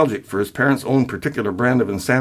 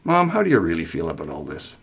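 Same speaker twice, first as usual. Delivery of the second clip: lower, gently probing question; gentle; 5 semitones lower, 3 dB quieter than usual. A narrator's reading. Son turns to his mother, gentle. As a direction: ask softly and kindly. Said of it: "Gently, he asked".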